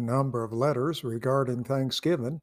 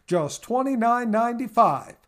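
higher, punched repeat of "twenty-nine ninety-five"; emphatic price repeat; 7 semitones higher, 4 dB louder than usual. Acted. Announcer repeating the price to listeners, higher and emphatic. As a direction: hit "twenty-nine ninety-five" harder the second time. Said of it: "You heard right".

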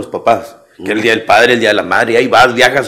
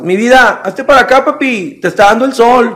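high, louder sugary coddling voice; mock doting parent; 11 semitones higher, 5 dB louder than usual. Overprotective parent calling child out of sun, high cooing. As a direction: overdo the fussy parent.

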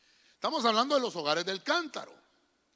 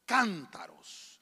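slow drawn-out reading; much slower than usual. Real, not acted. Preacher reads the verse to the church, drawn out. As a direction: stretch each word, very slow. Reading scripture slowly, stretched.

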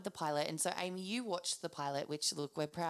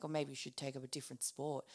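softer, lower, sly put-down; snide quiet jab; 4 semitones lower, 6 dB quieter than usual. Retold or imitated. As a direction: drop it as a smug little dig. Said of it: "and he's like".

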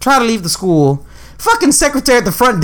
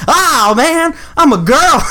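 mock secretary; 7 semitones higher, much slower than usual. Secretary jokes about handshake.

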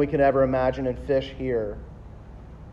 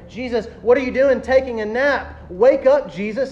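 high, louder mock-panicked plea; acted panic; 7 semitones higher, 4 dB louder than usual. Disciples to sleeping Jesus on the boat, panicked. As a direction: act out frantic disciples waking someone. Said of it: "start having panic attacks".

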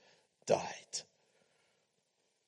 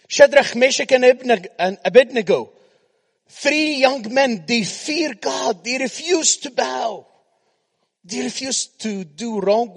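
preaching; 10 semitones higher, 9 dB louder than usual. Acted. Preacher telling of three men who refused to bow, emphatic preaching. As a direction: stretch each phrase out at full preaching force.

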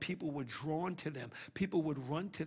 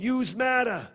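put-on Brooklyn accent; 7 semitones higher, 12 dB louder, slower than usual. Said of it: "say it like you're from Brooklyn".

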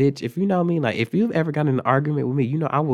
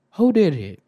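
loud, raised-pitch authority demand; stern demand; 6 semitones higher, 6 dB louder than usual. Retold or imitated. Assistant principal questioning the whole class, stern demand. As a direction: call it out like an accusing school official.

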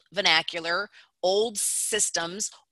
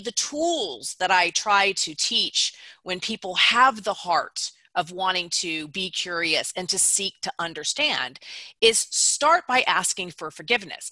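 ordinary talk

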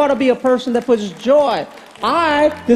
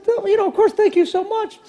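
much higher, giddy thank you; gushing thanks; 10 semitones higher than usual. Retold or imitated. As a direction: gush your thanks, giddy.

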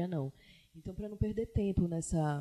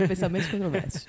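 laughing; 2 semitones higher, 5 dB louder, faster than usual. Real, not acted. Laughs at own controversial remark.

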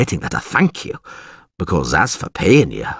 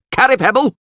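high-pitched holler of a name; calling out; 4 dB louder than usual. Acted. Alderman calling for an absent guard, hollering. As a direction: summon someone from another room. Alderman calls his men.

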